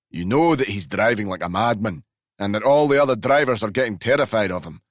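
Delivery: old Glasgow driver defending himself, hammed up; Glasgow accent. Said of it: "a very powerful Glasgow accent".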